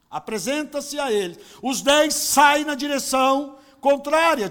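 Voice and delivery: loud, emphatic sermon preaching; full preaching. Acted. Pastor preaching to his church, loud and fervent. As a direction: preach it out to the congregation, driving each phrase. Preaching the ten lepers story.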